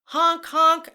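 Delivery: high, loud honk honk; loud horn honk. Shown as a demonstration. Teacher honking like a taxi for kids, loud. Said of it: "your turn to make the sound".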